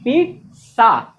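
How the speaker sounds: PIZZA drawn out slowly as peet-sa; right pronunciation. Shown as a demonstration. Teacher drills the class, पिज्जा as a slow "peet-sa". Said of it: "क्या होता है?".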